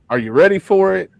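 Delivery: big excited game-show lift; hyped-up host. Acted. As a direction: pump up the guest, high and loud. Host hyping up the joke segment.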